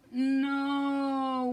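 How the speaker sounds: drawn-out wailed no; mock wail of dismay. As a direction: wail it in despair. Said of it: "wailed Bob".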